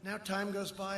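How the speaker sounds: usual speaking voice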